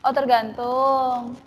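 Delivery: ordinary talk, nothing marked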